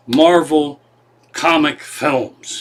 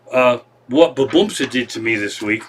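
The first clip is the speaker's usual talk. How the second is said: usual speaking voice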